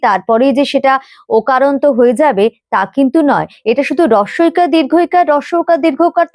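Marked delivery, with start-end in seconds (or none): none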